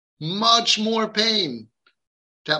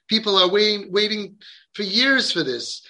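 ordinary delivery

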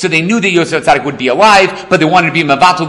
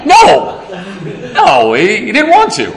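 laughing no; 3 semitones higher, slower than usual. Real, not acted. Laughing denial.

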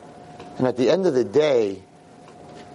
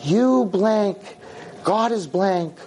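higher-pitched mock tirade; cursing; 6 semitones higher, slower than usual. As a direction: imitate a girl hurling curses. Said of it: "And she starts cursing me".

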